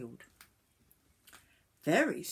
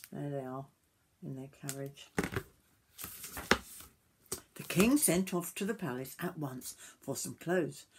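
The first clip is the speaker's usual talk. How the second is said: ordinary delivery, nothing marked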